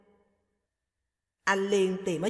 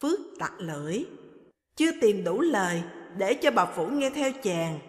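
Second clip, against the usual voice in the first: plain reading voice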